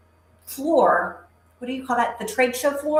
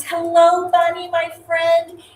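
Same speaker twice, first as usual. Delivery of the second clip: excited greeting; 9 semitones higher, 7 dB louder, slower than usual. Real, not acted. Livestream host greeting viewer Bunny, excited and loud. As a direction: sing out the hello, drawn out.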